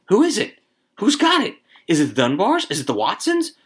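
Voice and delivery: high, eager crowd voices; excited questioning. A narrator's reading. Women all asking who drew the slip, excited.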